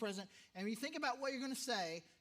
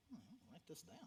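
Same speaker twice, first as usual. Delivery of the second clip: whispered aside; 4 semitones lower, 17 dB quieter, faster than usual. Retold or imitated. Student's wry inner reaction.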